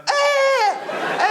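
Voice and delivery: very high shouted bleat; goat scream in the song. Retold or imitated. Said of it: "So it was like".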